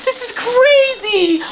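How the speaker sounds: slow, high, amazed exclaim; high-pitched excitement. Real, not acted. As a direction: squeal it out in disbelief. Overwhelmed unboxing a gift.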